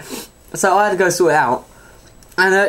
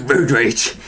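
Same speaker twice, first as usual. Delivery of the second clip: put-on old bloke deadpan; 7 semitones lower than usual. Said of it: "how much like Boris Johnson you sound".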